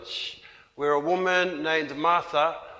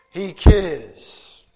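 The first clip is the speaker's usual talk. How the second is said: emphatic declaration; 9 dB louder than usual. Real, not acted. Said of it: "emphatic sermon repetition".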